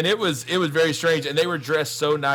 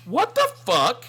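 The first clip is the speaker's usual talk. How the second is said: mock shocked woman; 10 semitones higher, 4 dB louder than usual. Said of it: "she goes".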